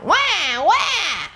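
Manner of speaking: put-on high wailing baby "wah"; mock baby cry. Storyteller voicing the hungry baby, mock high wail. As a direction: wail like a crying infant, hammed-up. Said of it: "and the baby was crying".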